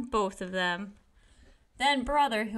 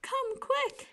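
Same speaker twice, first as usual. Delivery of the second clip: frightened cry; slower than usual. A narrator's reading. Sister calls her parents from the dark bedroom, panicked high cry. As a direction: call for help, terrified. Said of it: "she cried".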